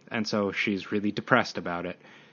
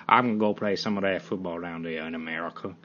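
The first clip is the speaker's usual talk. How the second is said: Southern accent; 6 semitones lower, 3 dB quieter than usual. Shown as a demonstration. Podcaster showing he can do Southern, Southern accent. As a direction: put on a low Southern accent. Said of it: "Well, I can do Southern".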